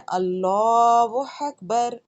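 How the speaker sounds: اللہ اکبر with full heavy lam, slow like the call; heavy Allahu akbar. Retold or imitated. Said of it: "موٹی آواز میں".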